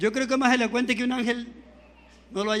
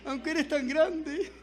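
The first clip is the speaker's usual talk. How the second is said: retold tearful prayer; 3 semitones higher, 4 dB quieter, slower than usual. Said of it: "Esa madrugada llorándole".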